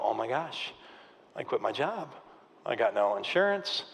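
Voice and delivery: low, soft voice of dawning dread; stunned realisation. Retold or imitated. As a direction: let the bad news sink in, quietly and low. Retelling his panic on the couch.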